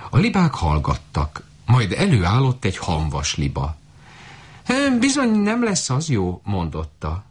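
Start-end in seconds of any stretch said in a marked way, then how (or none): 5.0-6.4 high honking goose voice; lightly voiced high goose. A narrator's reading. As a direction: squawk it like a fussy goose. Goose voice in story.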